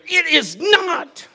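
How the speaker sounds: put-on high, slow, drawn-out little girl's denial; imitating the daughter dragging out her stubborn denial; animated daughter. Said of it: "she's very animated".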